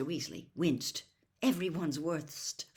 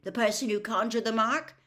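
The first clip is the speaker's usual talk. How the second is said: sharp; 6 semitones higher, 7 dB louder than usual. A narrator's reading. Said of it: "said Bill sharply".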